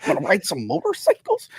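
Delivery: soft, mumbled bad American accent; hesitant, quiet mock-American movie voice; Tom Hardy. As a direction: mumble a wacky American accent, well under your breath. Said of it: "and his American accent's like".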